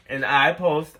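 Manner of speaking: usual speaking voice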